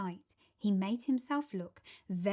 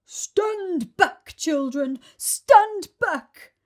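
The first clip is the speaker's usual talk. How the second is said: loud, high booming shout; elephant shout; 8 semitones higher, 11 dB louder, much slower than usual. Acted. Elephant shouts a warning at the children, loud. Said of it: "a big, deep voice from the jungle shouted".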